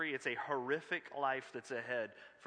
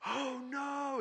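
sudden high yelp of panic; mock scream; 12 semitones higher than usual. Fishing guide realizing his rods are left behind, high panicked yelp. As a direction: squeal it like a guide who just lost his gear. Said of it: "And Mike just screams".

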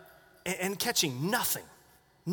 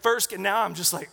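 ordinary delivery

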